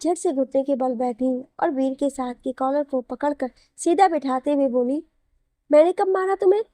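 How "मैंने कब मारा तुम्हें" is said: higher, louder outraged question; indignant disbelief. A narrator's reading. Wife indignantly demanding when she ever hit him.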